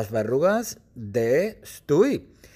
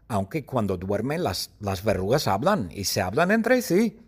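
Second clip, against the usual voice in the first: usual speaking voice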